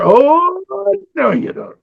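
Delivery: animated cartoon exclamation; livened up. Shown as a demonstration. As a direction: liven it up with surprise. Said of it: "Try to liven it up".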